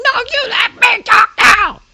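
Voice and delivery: very high, loud drawn-out scream; snapped, screeching demand to be heard. Retold or imitated. Worker finally snaps at coworker who keeps interrupting, screaming. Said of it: "screaming at him".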